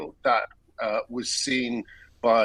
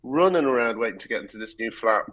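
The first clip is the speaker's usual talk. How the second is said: normal talking voice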